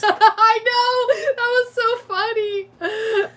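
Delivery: high, laughing exclamation; laughing agreement. Real, not acted. Co-host delighted by the variety show planet joke, laughing agreement. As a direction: squeal it through real laughter.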